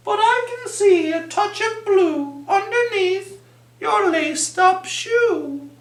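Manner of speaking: high, bright-voiced peacocks asking; squeaky curious peacock voices; chirpy peacocks.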